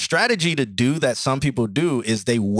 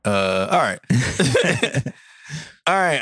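usual speaking voice

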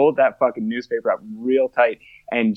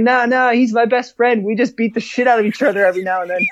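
higher and fast, brushing it off; high, fast pleading; 11 semitones higher than usual. Retold or imitated. To the police officers, insisting they're best friends, high and fast. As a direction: pitch it up high and rattle it off quickly. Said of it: "And I'm like".

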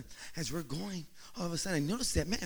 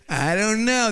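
higher-pitched mimicry of a wife; mock noncommittal wife; 4 semitones higher, 4 dB louder than usual. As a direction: imitate a wife dodging the question, pitched up. Mimicking wives' noncommittal answer.

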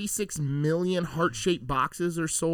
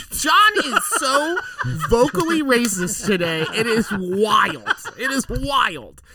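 laughing; 7 semitones higher, 4 dB louder than usual. Real, not acted. Laughing at friend's accent.

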